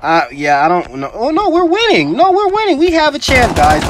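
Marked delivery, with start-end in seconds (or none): 1.1-2.8 high, disbelieving shriek of joy; shrieking jackpot winner. Real, not acted. Sudden comeback, team is winning. 2.8-3.5 high excited squeal; shrieking jackpot winner. Real, not acted. Sudden comeback, team is winning.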